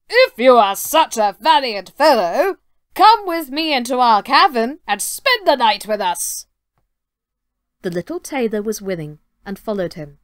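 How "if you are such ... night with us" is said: booming giant. Acted. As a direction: boom it out like a proud giant. Giant invites the tailor.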